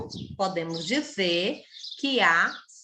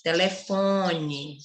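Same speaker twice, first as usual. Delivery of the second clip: "Telefone" slow and lower, FON held long; drawn-out stressed FON in "Telefone"; lingering on FON; 4 semitones lower, much slower than usual. Shown as a demonstration. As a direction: dwell on the third syllable of "Telefone". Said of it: "como eu demorei mais nela".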